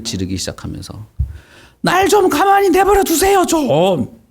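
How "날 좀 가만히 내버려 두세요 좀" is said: high-pitched, pleading outburst; acted yelling. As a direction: cry out in protest as the possessed man. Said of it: "막 소리를 지르기 시작한 거야".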